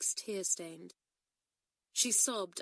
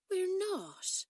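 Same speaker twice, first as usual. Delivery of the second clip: ordinary delivery, nothing marked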